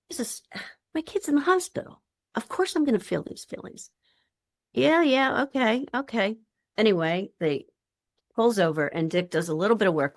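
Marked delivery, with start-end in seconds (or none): 0.1-3.9 much higher, fast indignant protest; exasperated father. Retold or imitated. Father snapping that his son is in hospital, high and fast. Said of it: "Frank Anderson's like". 4.7-6.4 reluctant giving in. Retold or imitated. Father grudgingly agreeing to pull over, higher and brisk. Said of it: "reluctant agreement".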